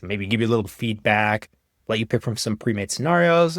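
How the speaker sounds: usual speaking voice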